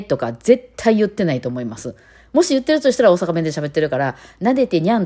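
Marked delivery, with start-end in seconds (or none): 4.4-5.0 higher, put-on kitty voice; mock cutesy cat talk. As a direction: parody a cat begging sweetly. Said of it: "とか絶対言ってないですからね".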